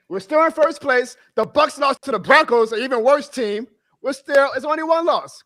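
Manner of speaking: loud, fired-up preaching tone; hyped preaching. Real, not acted. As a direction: preach it like a fan. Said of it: "my guy [name] preaching".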